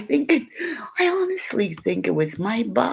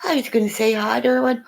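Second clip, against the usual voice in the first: ordinary delivery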